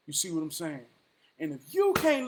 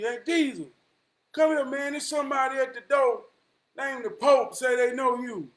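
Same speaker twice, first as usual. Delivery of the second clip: Paul calls Jesus to the door, high and loud; calling out; 7 semitones higher, 6 dB louder than usual. Retold or imitated. Paul summoning Jesus to heaven's door, calling loudly. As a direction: shout it up to Jesus across heaven.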